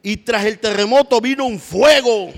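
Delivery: high, loud proclaim; preaching climax. Acted. Preacher to congregation, loud high cry of fire.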